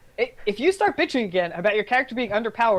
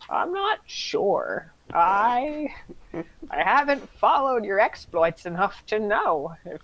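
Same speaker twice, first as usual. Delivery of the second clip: put-on old lady voice, slow and pitched up; 3 semitones higher, slower than usual. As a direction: draw it out like an elderly lady. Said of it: "say it in an old lady voice".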